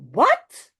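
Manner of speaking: startled father calling back; shouted. A narrator's reading. Father hearing about the letter, sharp loud shout. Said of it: "roept vader".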